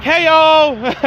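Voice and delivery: high, drawn-out hello to the group; cheery greeting. Acted. Hologram message greeting two friends, cheery high call. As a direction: call out an upbeat, performed hello.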